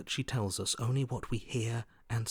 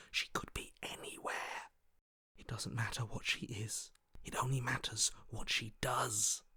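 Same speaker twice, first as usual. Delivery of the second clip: hushed, softer whisper; 6 dB quieter than usual. Acted. Hunted voice warns companion in the jungle, whispered. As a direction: whisper as if she might hear you.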